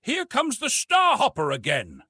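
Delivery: urgent shout of a warning; yelling. Acted. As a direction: call it out across the bridge. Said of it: "somebody else was yelling".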